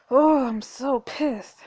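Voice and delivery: slow, drawn-out real annoyance; seething, stretched out; angry. Real, not acted. Player fumes after Harper is fired, slow and seething.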